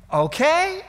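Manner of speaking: outraged disbelief; incredulous okay. Real, not acted. Incredulous repeat of God's okay.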